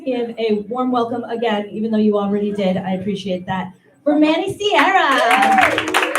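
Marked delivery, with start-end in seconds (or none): 4.0-6.2 slow, loud, higher announcer call; announcer intro. Real, not acted. Host brings up the next comic, loud drawn-out call. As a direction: stretch the name out for the crowd.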